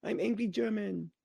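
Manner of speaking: high, softening mock plea; pitiful villain's grovelling apology, hammed-up; begging for life. Villain on his knees begging the hero for mercy, mock pleading. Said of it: "he starts begging for his life".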